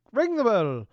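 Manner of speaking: goofy drawn-out, rising-pitched bell plug; silly loud singsong plug; odd goofy delivery. Pretend. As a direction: stretch it out high and loud, playfully. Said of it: "I don't even know what the hell that was".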